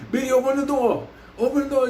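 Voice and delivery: calling through door. Retold or imitated. Mike went to the door, retold insistent call. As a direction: imitate a friend calling to be let in.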